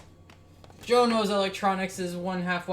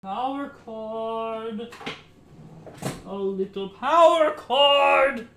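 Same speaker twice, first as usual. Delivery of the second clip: slow, higher sing-song; drawn-out musing; 5 semitones higher, 7 dB louder, much slower than usual. Real, not acted. Streamer searching for a power cord, slow sing-song. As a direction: stretch the words out, searching.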